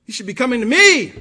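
how dare he, say it loud; indignant. Acted. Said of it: "as if to say".

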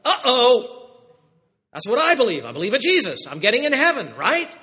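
mock startled believer, high and loud. Preacher voicing a startled listener, high and loud. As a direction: act out a churchgoer suddenly worried about heaven.

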